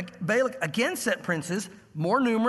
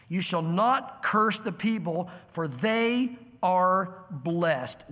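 very slow, deliberate pronouncement; emphatic; 2 semitones lower, much slower than usual. Retold or imitated. Preacher voicing God's emphatic ruling to Balaam, very slow. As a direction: draw out every word like a final ruling. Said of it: "God emphatically says".

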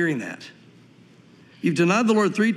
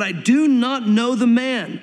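slow, higher, hammered-out oath; forceful sworn denial; 4 semitones higher, 3 dB louder, slower than usual. Retold or imitated. Peter denies Jesus a third time, cursing and swearing. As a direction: swear it firmly, word by weighted word. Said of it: "began to curse and swear".

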